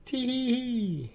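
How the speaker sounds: high slow sneaky tee-hee; mischievous chuckle. A narrator's reading. Mister Mischief after changing the clock, high sneaky giggle. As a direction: giggle to yourself over a naughty trick. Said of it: "he chuckled mischievously to himself".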